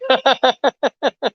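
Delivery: squealing high laugh; high cackling laugh. Real, not acted. Laughing at his wife, high cackling laugh.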